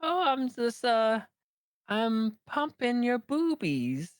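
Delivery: put-on high, slow little boy voice; little kid voice. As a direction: say it like an innocent toddler. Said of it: "And he's like".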